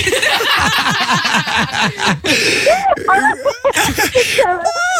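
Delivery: high, helpless laugh breaking out; big burst of laughter. Real, not acted. Prank caller breaking down in high laughter. Host loses it mid-prank.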